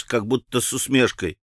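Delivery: plain reading voice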